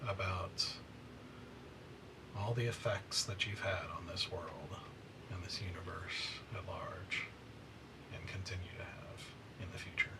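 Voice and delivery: quiet whispered narration; whispering. Acted. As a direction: let the ending fade in a whisper. Whispered epilogue.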